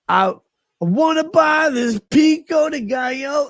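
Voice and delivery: high, slow, mock furious yell; livid shout. Retelling how she yelled about buying the food, furious shout. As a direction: bellow it like she's losing it. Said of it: "She goes fucking livid".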